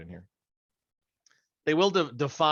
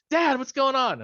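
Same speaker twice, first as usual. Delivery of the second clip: put-on high, loud kid voice bursting in; son's voice; 7 dB louder, slower than usual. Teenage son bursting into dad's exam room, high and loud.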